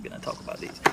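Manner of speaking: whispered aside. Real, not acted. Muttered aside, unvoiced.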